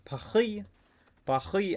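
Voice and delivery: high Paris said Pah-ree with a throaty r; mock French. Streamer joking to chat in a mock French accent. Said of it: "I took a little bit of French class".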